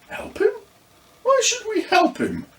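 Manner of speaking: put-on high, incredulous child voice; can't believe the suggestion; boy balking at helping the ghost; indignant boy. A narrator's reading. Luca objects, high voiced.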